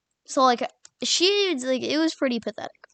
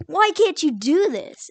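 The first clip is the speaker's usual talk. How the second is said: higher, drawn-out mock exasperation; exasperated demand; 5 semitones higher, 4 dB louder, slower than usual. To classmate stuck on basic decimals, exasperated. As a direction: reenact losing patience, slow and raised.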